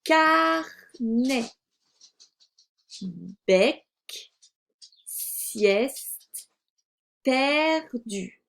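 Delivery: slow, separate words with long pauses; word-list reading. Shown as a demonstration. Teacher reads the first column, slow isolated words. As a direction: read each word alone, syllables clearly set apart. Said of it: "On lit la première colonne".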